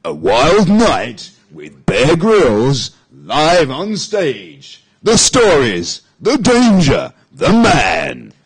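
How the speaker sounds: big, slow movie-trailer announcer with drawn-out key words. Shown as a demonstration. Host reads show blurb to co-host, movie trailer voice. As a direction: boom it out like a film trailer. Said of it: "Shall I do it in the movie voice?".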